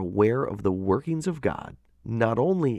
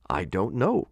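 ordinary delivery

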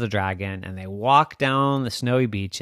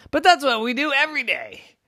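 high-pitched, loud objection; protesting; 7 dB louder than usual. Acted. Captain objects to the ghost's island advice, high protesting voice.

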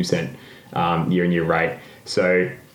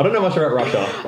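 laughing; 7 semitones higher, faster than usual. Real, not acted. Laughing after humongous bit.

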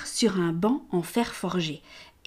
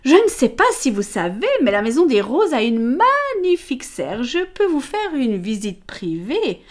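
higher, louder, cooing flirt; flirtatious lady; 4 semitones higher, 5 dB louder than usual. Acted. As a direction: lure him with sugary charm. Amelia lures him to greenhouse. (dame charmeuse, aiguë et roucoulante)

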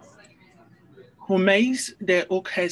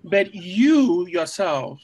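"but you yourself" slow and louder, leaning on "you yourself"; slow, weighted stress on "you yourself"; emphatic; 5 dB louder, slower than usual. Shown as a demonstration. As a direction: press hard on "you yourself". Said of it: "I would take the humeis in emphatic".

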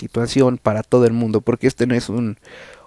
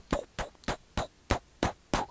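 soft unvoiced pu pu pu hammer taps; breathy mouth knocks like a distant hammer; imitated hammering; 16 dB quieter than usual. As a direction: tap out the hammer noise with the lips.

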